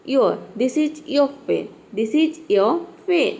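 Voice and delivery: slow model of This is your pen; slow correct demo. Shown as a demonstration. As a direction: stretch the य for learners. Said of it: "य को थोड़ा लंबा बोलना है".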